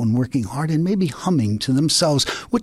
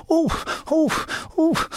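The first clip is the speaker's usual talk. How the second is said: much higher sobbing oh; high crying wail. Acted. Rabbit wailing over his missing tail. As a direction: cry out in dismay.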